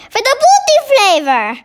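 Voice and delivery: high-pitched giant's interjection; excited cry. A narrator's reading. BFG interrupts the military men with a high cry. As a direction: pipe up high and eager. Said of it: "cried the B F G".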